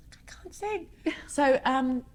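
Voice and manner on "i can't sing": helpless whine. Retold or imitated. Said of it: "I'm like".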